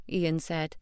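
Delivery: ordinary delivery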